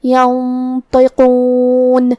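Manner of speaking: ینطقون with the nun hidden and nasal, the ط and ق heavy; heavy letters. Shown as a demonstration. Teacher demonstrates ینطقون with hidden nun and heavy ط. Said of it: "بھاری آواز میں پڑھیں گے".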